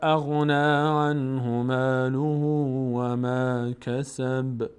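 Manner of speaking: drawn-out, chanted verse; slow Quran recitation. Shown as a demonstration. Teacher recites the verse slowly for students, chanted. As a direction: recite slowly, stretching each vowel. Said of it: "recites verse".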